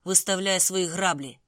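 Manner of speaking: loud, slow barked dare; barked challenge. A narrator's reading. Lin squaring up to Andrew, a loud slow bark. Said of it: "гаркнув я у відповідь".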